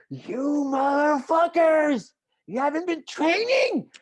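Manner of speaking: high, slow roaring English yell at the team; furious shout. Retold or imitated. Coach yelling at the team for not training, furious high slow shout. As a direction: bellow it like a furious coach tearing into lazy athletes.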